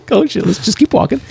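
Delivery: high, anxious "oh shit, keep walking"; scared guy edging away; panicky mutter. Retold or imitated. Retelling panic near suspect.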